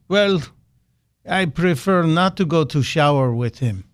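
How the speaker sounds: lightly hammed-up Russian accent as Putin; bad Putin voice. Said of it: "again in my bad Putin voice".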